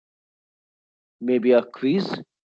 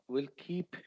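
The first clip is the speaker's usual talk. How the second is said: ordinary delivery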